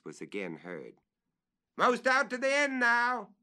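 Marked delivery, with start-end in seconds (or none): 1.7-3.3 much higher, louder call. Acted. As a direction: report from the limb's end.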